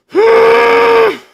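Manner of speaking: high, loud cry of frustration; loud anguished groan. Real, not acted. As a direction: let out a pained wail at the refs' spot. Team stopped short on third down.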